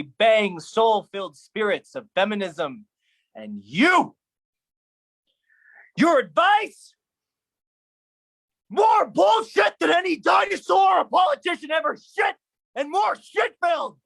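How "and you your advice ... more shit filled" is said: screamed high and loud at him; yelling high and loud in contempt; furious shout. Acted. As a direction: let the rage spill out. Raging at father's advice.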